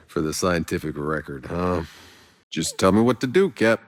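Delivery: usual speaking voice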